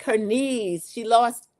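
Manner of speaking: usual speaking voice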